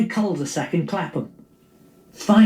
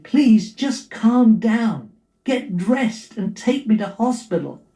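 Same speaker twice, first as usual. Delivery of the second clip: higher and slow, pleading to calm him; slow, insistent plea, voice raised in pitch; pleading wife; 7 semitones higher, slower than usual. Acted. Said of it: "she pleaded".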